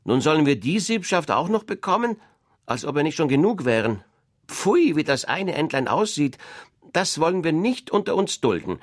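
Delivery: loud, high, contemptuous duck chatter; scornful yard ducks. Acted. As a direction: sneer at the new family.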